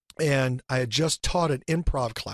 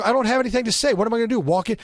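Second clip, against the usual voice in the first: higher and louder, protesting whine; arguing with himself; 8 semitones higher, 5 dB louder, faster than usual. Retold or imitated. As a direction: push back on himself, voice up and loud. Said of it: "and I would argue".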